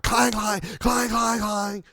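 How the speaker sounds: high, loud repeated clang of a broken cart wheel; cart clanging noise. Retold or imitated. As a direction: bang it out like a rattling metal cart.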